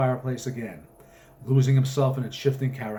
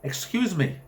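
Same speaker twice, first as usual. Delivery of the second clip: sharp, higher, commanding interruption; 6 semitones higher than usual. Acted. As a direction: cut him off with polite authority. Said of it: "in a sharp and commanding tone".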